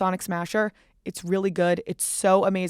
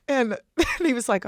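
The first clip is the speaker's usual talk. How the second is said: ordinary delivery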